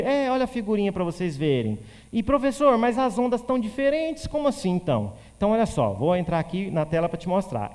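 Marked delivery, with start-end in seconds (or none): 2.1-5.1 puzzled student. Pretend. Voiced student confused by the different waves, higher and puzzled.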